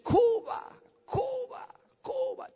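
high, echoing mock shout, fading after the first; high repeated call, held back in volume; Vasily. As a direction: imitate a cry ringing in the head. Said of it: "la voz de Vasily gritar dentro de mí".

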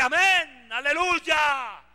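drawn-out, loud amen aleluya; slow hammed-up church cheer; mock amen. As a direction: stretch it out like a fired-up congregation. Mock congregation response.

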